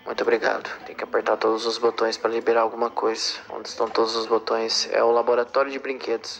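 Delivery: mock deep voice. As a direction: drop deep and flat like a bored robot. Exaggerated low polite voice.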